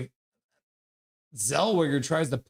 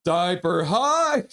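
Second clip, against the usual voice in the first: slow, higher, drawn-out call; acted hype cheer; 4 semitones higher, 4 dB louder, much slower than usual. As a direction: stretch it out like a playful rallying cry. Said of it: "says Co-, Coach Rob Willie".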